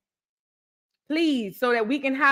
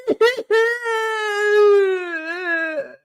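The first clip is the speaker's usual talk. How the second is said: high laugh breaking toward tears; tearful laugh; 11 semitones higher, 4 dB louder than usual. Real, not acted. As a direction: laugh through almost-crying. Overwhelmed by gift dolls.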